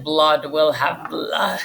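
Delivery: slow, grim recited line; revengeful line. Shown as a demonstration. Teacher reading Macbeth's revengeful line, slow and grim. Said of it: "That's like a revengeful one".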